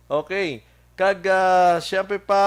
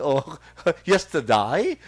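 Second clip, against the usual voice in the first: laughing tease; slower than usual. Real, not acted. Teasing a returning listener.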